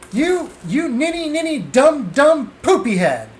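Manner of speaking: put-on high, sputtering kid's name-calling; high-pitched childish outrage; childish tantrum. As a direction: splutter like a furious child stuck for words. Kid sputters insults at the cheat.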